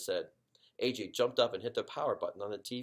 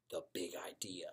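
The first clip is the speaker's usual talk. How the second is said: hushed whisper; a soft conspiratorial whisper; whispered reveal; 4 semitones lower, 8 dB quieter than usual. A narrator's reading. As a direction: whisper it like a secret. Reveal of a secret idea.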